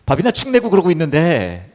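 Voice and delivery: heightened indignant delivery; mock exasperation. Shown as a demonstration. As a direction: complain loudly about the freeloader. Exasperated description, then contrasted as lovely.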